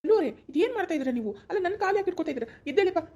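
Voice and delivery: acted shock, urging her up; flustered protest. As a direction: voice jumps up in alarmed embarrassment. Startled as she touches his feet.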